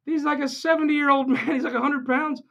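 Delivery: high, laughing disbelief; incredulous retort. Retold or imitated. Answering Greg in high, laughing disbelief. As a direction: scoff at the idea he's scary. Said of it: "I'm like".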